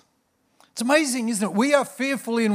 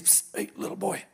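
whisper; 2 semitones higher, 8 dB quieter than usual. Acted. Frog calls again unseen.

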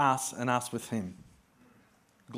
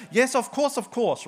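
absent-minded agreeing; 8 semitones higher, 4 dB louder than usual. Retold or imitated. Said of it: "and I go".

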